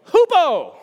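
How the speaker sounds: loud, high, drawn-out call; big high chant-like shout; with gusto. Shown as a demonstration. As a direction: belt out Hupo for the crowd to repeat. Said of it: "say it with some gusto".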